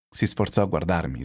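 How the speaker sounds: plain reading voice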